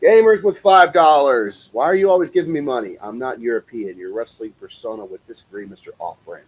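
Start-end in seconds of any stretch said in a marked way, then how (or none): none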